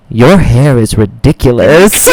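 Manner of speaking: slow, drawn-out mock judgement, swooping at the end; mock disbelief. Drunk man on the train judging someone's hair, mock disbelief. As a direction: imitate a stranger's slow, incredulous verdict. Mocking stranger's remark retold.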